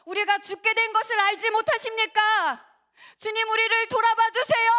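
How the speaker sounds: frightened disciples begging their master; desperate pleading. Retold or imitated. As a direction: cry out to be saved from the storm. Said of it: "제자들이".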